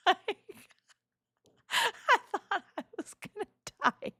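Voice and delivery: high breathless laugh through the words; giggling high while recalling the panic. Real, not acted. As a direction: laugh it out, barely voiced. Retelling ride pause, laughing.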